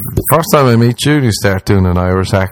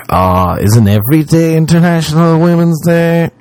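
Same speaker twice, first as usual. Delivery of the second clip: higher-pitched mock-earnest dude; mock nice guy; 8 semitones higher than usual. Dudes posting on Women's Day, mock-earnest and high. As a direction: put on a fawning guy's raised voice. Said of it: "a lot of dudes have been like".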